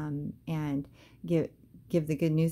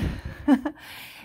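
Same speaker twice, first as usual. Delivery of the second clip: high breathless laugh; 11 semitones higher, 4 dB louder than usual. Real, not acted. Hearing about the money briefcase.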